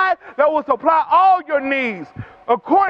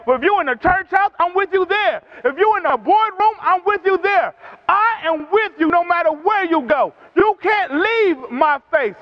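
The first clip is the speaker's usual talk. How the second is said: preaching; 4 semitones higher than usual. Acted. Preaching builds to full force.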